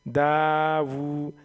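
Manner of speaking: very slow, drawn out syllables; stretched long vowel. Shown as a demonstration. Teacher shows how to lengthen the vowel. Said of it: "इसको भी खींच कर पढ़ेंगे".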